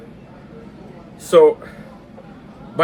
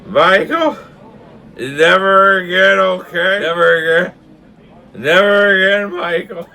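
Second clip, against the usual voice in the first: imitated drunken dad; 8 semitones higher, 8 dB louder than usual. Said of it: "my dad's in the front saying".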